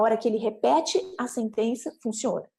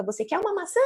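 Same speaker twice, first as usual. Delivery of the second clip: put-on high childlike repeated question; echolalic child question; 8 semitones higher, faster than usual. Child keeps echoing the parent's offer, high questioning. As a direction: echo it high, like a child copying adults. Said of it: "continua repetindo ecolalicamente essa pergunta".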